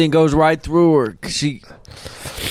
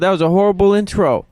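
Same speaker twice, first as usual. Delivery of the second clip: usual speaking voice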